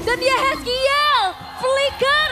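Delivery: high, loud, slow play-by-play shout; hype shouting caster. Real, not acted. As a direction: scream the highlight call. Peak moment of team fight.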